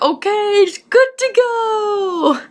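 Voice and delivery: high, slow, sing-song mock cheer; mock chirpy computer voice; mock cheerful webpage. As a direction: parody the page chirping that all is fine. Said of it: "it was like".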